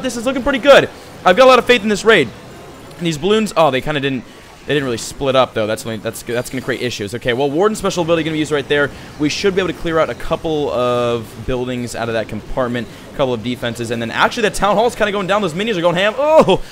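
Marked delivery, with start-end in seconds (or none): 0.0-2.3 higher and louder, hyped up; excited. Real, not acted. Raid going well, hyped. 16.2-16.6 high, loud sudden "oh"; surprised cry. Real, not acted. Raid nearly succeeds.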